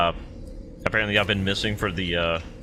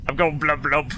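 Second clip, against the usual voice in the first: gurgling fish man croak; 6 semitones higher, 4 dB louder than usual. Pretend. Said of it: "I'm losing air".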